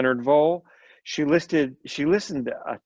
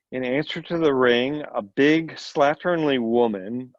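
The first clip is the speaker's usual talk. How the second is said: ordinary delivery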